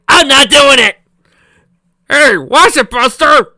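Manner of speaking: high, loud indignant yell; loud protest. Real, not acted. Reader yelling a protest at the story, high and loud. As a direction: shout back indignantly.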